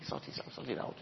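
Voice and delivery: a hushed, insistent repeat; whispered urging. Real, not acted. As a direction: whisper it, pressing the point home. Preacher urging, repeats phrase.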